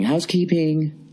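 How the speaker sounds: high, slow sing-song "Housekeeping."; mock housekeeper call. Mocking the cleaning lady who barges in. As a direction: imitate the maid calling through the door.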